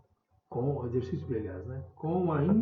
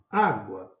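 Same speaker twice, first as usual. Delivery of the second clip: Á loud, falling away in água; calling out; 5 semitones lower, 6 dB louder than usual. Shown as a demonstration. Teacher marking the tonic syllable, stressed Á in água. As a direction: hit Á in "água", drop the rest. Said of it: "chamar ela mais forte".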